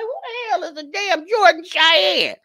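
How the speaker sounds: high-pitched, baffled self-quote; incredulous outburst. Retold or imitated. Vlogger retelling her first reaction to a stranger's name, incredulous. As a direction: squeal it in comic disbelief.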